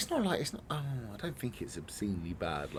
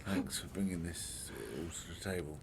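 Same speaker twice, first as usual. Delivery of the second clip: sarcastic whisper; 2 semitones lower, 6 dB quieter than usual. Real, not acted. Sarcastic thanks.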